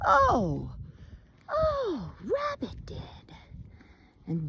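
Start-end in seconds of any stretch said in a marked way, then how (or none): 0.1-3.4 much higher, drawn-out knowing oh; dawning realization. Acted. Bear realizing Rabbit started it, knowing and high. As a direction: let it click slowly.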